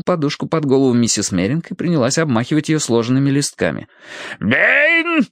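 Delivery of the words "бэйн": drawn, high-pitched angry bellow; roaring. A narrator's reading. Colonel calls for the absent butler, roaring. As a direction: roar the name out. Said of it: "рычал полковник".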